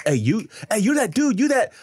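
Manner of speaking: high, loud, gushing fan voice; overexcited fan. Retold or imitated. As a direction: mimic a starstruck fan blurting it out.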